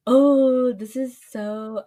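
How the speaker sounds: thrilled to be asked; breathless excitement; excited gasp. Real, not acted. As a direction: gasp then slow eager start. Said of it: "gasps at question".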